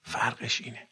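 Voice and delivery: ordinary talk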